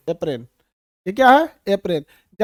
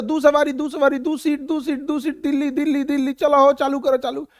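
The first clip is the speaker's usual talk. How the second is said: tempo driver calling; 4 semitones higher than usual. Retold or imitated. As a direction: bark out the seats like a tempo driver. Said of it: "tempo वाला कैसे बोलता है".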